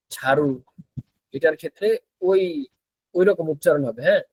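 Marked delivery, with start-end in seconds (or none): none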